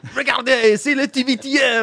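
high, loud French announcing voice; playful French call with a laugh; mock French. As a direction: shout it like a Parisian hawker.

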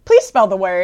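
a judge nudging the speller; mock stern judge; prompting judge. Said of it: "they're like".

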